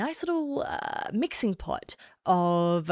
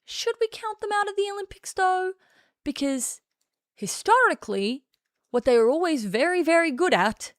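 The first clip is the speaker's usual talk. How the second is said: a hint of a sensible, reasoning voice, higher; intelligent person; 5 semitones higher than usual. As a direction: play the calm reasonable voice. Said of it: "An intelligent person would say".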